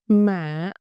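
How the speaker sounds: "Mạ" said as mả, pitch dipping. Shown as a demonstration. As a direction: dip down through "Mạ". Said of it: "is dipping".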